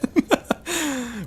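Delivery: high-pitched giggle burst; high giddy laugh. Real, not acted. Giddy laugh mid-game.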